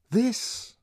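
high, emphatic pointing; lightly voiced Bernard, insisting; Bernard's voice. A narrator's reading.

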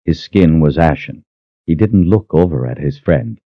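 ordinary delivery, nothing marked

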